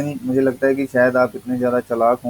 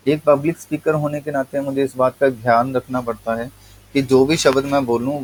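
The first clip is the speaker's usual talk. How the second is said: usual speaking voice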